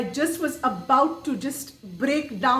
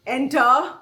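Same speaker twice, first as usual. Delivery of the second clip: stern command; 6 dB louder than usual. Retold or imitated. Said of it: "came the command".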